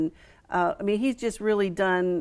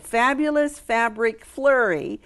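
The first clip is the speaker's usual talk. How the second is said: slow, deliberate enunciation of a tongue-twisting name; slow, careful; 3 semitones higher, 3 dB louder, slower than usual. Shown as a demonstration. Host naming the fun fabric event, slow and careful. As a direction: say it slowly, word by word. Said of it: "you have to say this slow".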